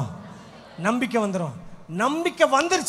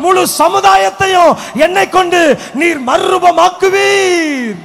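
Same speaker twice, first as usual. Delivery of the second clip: loud, higher, pressing prayer; fervent prayer; 5 semitones higher, 10 dB louder than usual. Acted. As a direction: cry out to God with rising fervour. (தீவிர ஜெப முழக்கம்)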